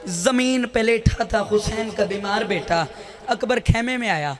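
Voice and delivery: ordinary talk, nothing marked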